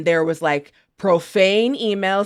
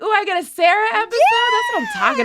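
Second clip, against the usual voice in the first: high, fast giddy squeal rising to a shout; thrilled mock squeal; excited squeal; 14 semitones higher, faster than usual. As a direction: gush like you just got great news. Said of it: "I was like".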